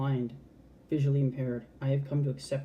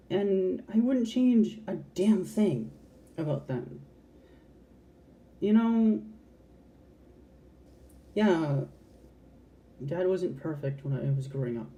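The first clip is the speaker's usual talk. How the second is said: getting emotional; 4 semitones higher than usual. Real, not acted. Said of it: "Sorry, I don't mean to get emotional".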